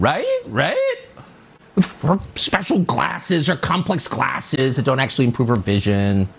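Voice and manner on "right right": mocking "Right?" flung sharply up in pitch; rising inflection at the end. Host mimicking an activist's questioning tag, inflection at the end. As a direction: mimic her "Right?" with a high upturned end. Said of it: "inflection at the end".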